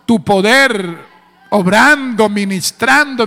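loud, slow preacher's cry; preaching prayer. Acted. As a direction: call out God's power over the church. Preacher calling on God's power.

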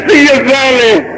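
high, slow host catchphrase; drawn-out call. Real, not acted. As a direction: stretch it out as a call to the crowd. (pregón agudo y alargado)

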